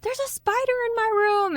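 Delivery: very high mock-panicked kid voice; screaming child. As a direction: wail it like a frightened little boy. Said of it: "Michael is screaming".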